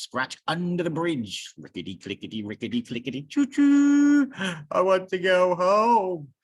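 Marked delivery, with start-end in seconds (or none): 3.2-4.3 high, loud choo choo like a train whistle. Acted. Reading a picture book to kids, train whistle choo choo. 4.7-6.3 high, loud wail. Acted. Bear after the wave wails to go home.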